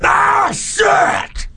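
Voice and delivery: high, wailing panicked yell; mock panicked wail. Friend in the car, high wailing yell of panic. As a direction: wail it like the world ended. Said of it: "He goes".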